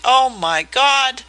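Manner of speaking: high, loud and slow gasp of terror; appalled, drawn-out outcry; horrified cry. A narrator's reading. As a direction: cry out in horror. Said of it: "he shrieked".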